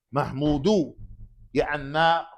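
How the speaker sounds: ordinary talk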